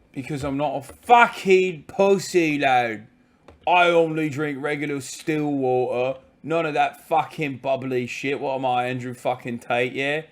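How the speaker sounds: hammed-up British tough-guy accent; exaggerated British accent, playful bluster; mock British lad. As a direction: drawl it slowly like a cocky British lad. Comic British tough lad routine.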